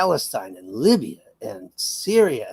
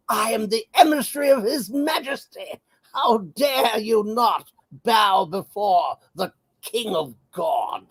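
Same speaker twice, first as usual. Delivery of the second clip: put-on shouted British indignation in a posh accent; 7 semitones higher than usual. Lammy scolding the Russian delegate in a posh British accent. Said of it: "with this posh British accent".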